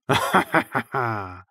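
creepy clown chuckle; a short mocking laugh; sinister amused laugh; clown's laugh. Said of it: "Zasmál se klaun".

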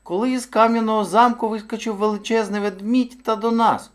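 high-pitched storybook hare; hare telling his tale. A narrator's reading. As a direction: recount it as a small anxious hare.

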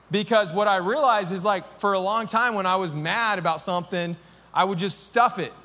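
ordinary talk, nothing marked

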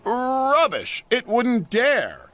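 role. Acted. James boasting, high voice.

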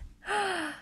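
quick gasp of wonder; small amazed gasp; delighted gasp. Real, not acted. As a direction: gasp as the sparkle catches the light. Delighted by sparkling ink.

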